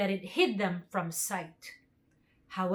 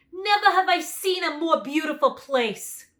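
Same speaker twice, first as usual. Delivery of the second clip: loud, raised lion voice; the lion, delighted and booming; lion's voice; 7 semitones higher, 9 dB louder than usual. A narrator's reading. Lion praises forest.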